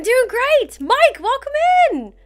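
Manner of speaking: high, lively call to a newcomer; loud, high-pitched cheery greeting; excited welcome. Real, not acted. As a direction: greet them with a big upward lift in pitch. Calling out welcome to viewer.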